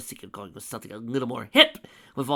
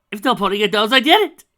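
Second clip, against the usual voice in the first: much louder, gloating villain yell; triumphant shout; 10 semitones higher, 16 dB louder than usual. Acted. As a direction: shout it right in his face. Villain reveals his motive.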